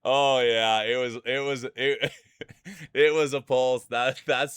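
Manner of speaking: loud, breaking into laughter; laughing hard. Real, not acted. Streamer recalling last night's joke, laughing loudly. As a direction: laugh the words out between giggles.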